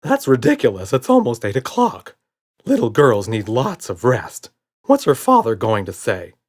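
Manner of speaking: fussy little monster Red. A narrator's reading. Red frets to Buddy about the girl's bedtime, loud and fussy. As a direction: fuss like a worried little creature. Red's nervous voice.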